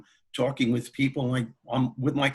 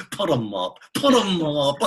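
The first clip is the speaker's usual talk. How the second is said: higher, louder cartoonish lion taunt, rolling the words; Wizard of Oz lion; 5 semitones higher, 6 dB louder than usual. Daring a biker in a bar, hammed-up Oz lion voice. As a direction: puff up like the storybook lion daring a fight. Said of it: "I turned into the lion from The Wizard of Oz".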